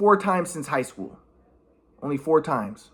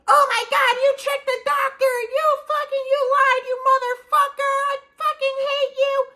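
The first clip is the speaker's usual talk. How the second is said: much higher shrill-free mom voice, yelling; mocking mom. Imitating his mom raging on the phone, much higher. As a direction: mimic mom screaming at him. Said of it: "my mom says".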